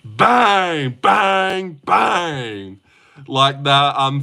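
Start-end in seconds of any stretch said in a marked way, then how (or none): 0.0-2.8 high, long-held bangs; NBA commentator. Acted. Streamer copying a famous NBA commentator, high drawn-out calls. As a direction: commentator crowing each bang over the crowd. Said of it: "that famous N B A commentator".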